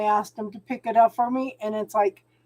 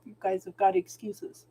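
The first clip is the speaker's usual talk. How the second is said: normal talking voice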